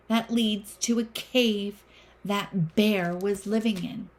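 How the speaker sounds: usual speaking voice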